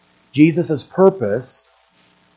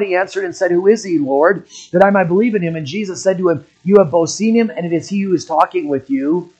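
raised, ringing pulpit reading of scripture; preaching at full force; 5 semitones higher, 4 dB louder than usual. Acted. Preacher reading the Gospel to his church, raised and ringing. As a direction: proclaim the verses to the congregation.

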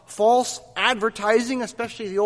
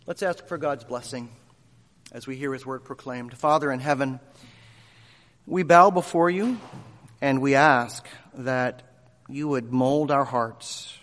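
prayer; 6 semitones lower than usual. Acted. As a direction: pray humbly before the sermon. Pastor prays before sermon.

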